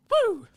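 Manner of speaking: short, loud, very high woo; high whoop. Real, not acted. Streamer alone watching the cup final, high loud whoop. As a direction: let out a sharp high whoop. Lone whoop during the match.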